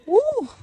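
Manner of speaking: very high "woo"; happy whoop. Real, not acted. Happy author celebrating on her walk, high whoop. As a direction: let out a short joyful whoop.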